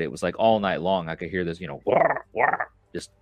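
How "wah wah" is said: higher croaky "wah, wah" sounds; imitated frog croak. Telling a friend about the frogs croaking all night, mock croak. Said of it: "they're all croaking around me".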